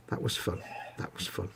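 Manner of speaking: whispered sarcastic aside. Real, not acted. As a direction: breathe it out sarcastically. Sarcastic fun live on telly.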